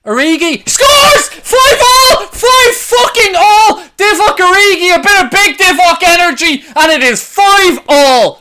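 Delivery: screaming with joy, high and loud; goal celebration. Real, not acted. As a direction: roar it out like the equaliser just went in. Live goal celebration, shouting.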